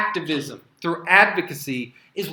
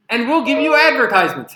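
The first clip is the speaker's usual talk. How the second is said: loud, higher, mock-generous announcement; sarcastic punchline; 6 semitones higher, 9 dB louder than usual. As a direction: announce the ads like a grand gift.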